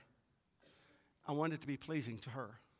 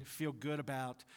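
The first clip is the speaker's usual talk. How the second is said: ordinary talk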